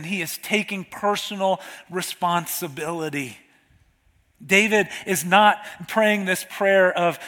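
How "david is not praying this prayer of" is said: usual speaking voice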